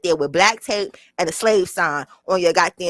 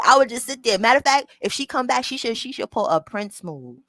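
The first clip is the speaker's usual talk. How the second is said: usual speaking voice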